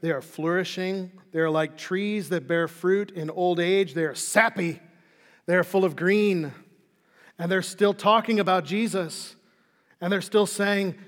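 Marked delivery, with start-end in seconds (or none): none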